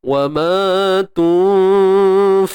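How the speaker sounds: chanted tajwid recitation; Quran recitation. Shown as a demonstration. Teacher resuming the verse, chanted recitation. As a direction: sing the phrase as in recitation.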